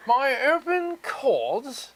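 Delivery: high, prim mock British gent; mock English accent. Streamer answering a viewer, in a mock posh English accent. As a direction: play a dainty English gentleman. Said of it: "Hello, I'm [name]".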